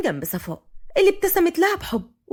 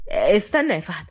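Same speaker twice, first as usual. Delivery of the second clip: lowered voice of a family elder; grandfather; 7 semitones lower than usual. A narrator's reading. Grandfather to the latecomers, low questioning.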